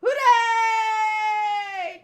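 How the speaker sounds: long drawn-out high, loud cheer; big celebratory hooray. Acted. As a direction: whoop it out and hold the last vowel. Reads crew cheering at party.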